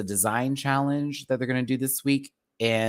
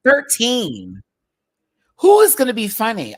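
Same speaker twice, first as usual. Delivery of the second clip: thirteen, much higher and louder; loud exclaim; 10 semitones higher, 10 dB louder than usual. Real, not acted. Streamer exclaiming at the cast size, loudly. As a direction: blurt it out loud in shock.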